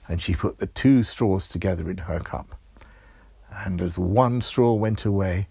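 ordinary delivery, nothing marked

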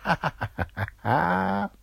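high, put-on staccato laugh; rising acted ha-ha-ha; waitress's laugh. Waitress laughing after her reply to Georgia, high staccato laugh.

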